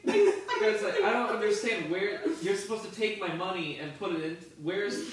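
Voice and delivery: a baffled customer who can't stop laughing; laughing confused customer. Acted. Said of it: "but it's just like".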